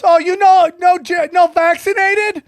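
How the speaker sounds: mock Indian accent, pitched high; imitated Indian accent. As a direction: draw it out as the shop lady. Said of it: "Indian accent".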